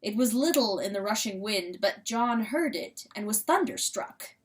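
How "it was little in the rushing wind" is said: ordinary delivery, nothing marked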